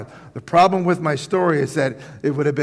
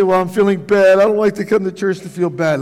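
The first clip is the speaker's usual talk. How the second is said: complaining churchgoer; 4 semitones higher, 6 dB louder, faster than usual. Retold or imitated. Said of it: "You say".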